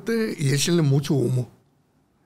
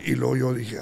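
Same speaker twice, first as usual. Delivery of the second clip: ordinary talk, nothing marked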